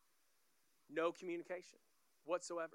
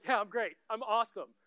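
loud, raised, hammed-up tearful reassurance; mock bawling; 3 semitones higher, 9 dB louder than usual. Bawling dad reassures his daughter in the car, mock bawling. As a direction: insist you're fine through an imitated bawl. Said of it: "And man, I'm bawling".

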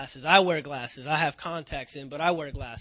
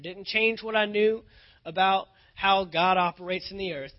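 fervent preaching; 3 semitones higher, 4 dB louder than usual. Acted. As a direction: build each line like a drumbeat. Preaching build, repeated didn't change.

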